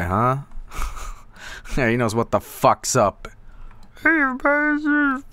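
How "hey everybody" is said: high squeaky greeting. Pretend.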